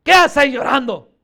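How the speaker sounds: loud, stern acted reproach; forceful apostle's reprimand; firm rebuke. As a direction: scold them for their weeping. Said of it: "Así me imagino que les habló".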